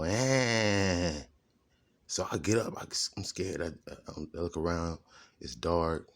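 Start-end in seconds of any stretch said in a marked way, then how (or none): 0.0-1.1 loud, high, drawn-out "ehhh"; imitated scary sound. Retelling the noise that woke him in the crib, imitated loud wail. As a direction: let out a long, raised wail of a noise. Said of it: "I would hear the sound go".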